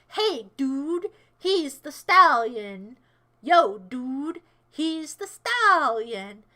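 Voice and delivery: loud, slow, stretched-out bro cheer; dude hype. Pretend. Bro hyping up the stallion, drawn-out cheer. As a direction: drag out the key word like a hype man.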